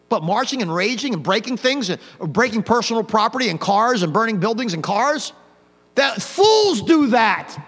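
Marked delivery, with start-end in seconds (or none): 5.9-7.6 slow, hard pulpit shout; condemning preacher shout. Real, not acted. Preacher condemning those burning buildings and cars, slow emphatic shout. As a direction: shout it down with drawn-out force.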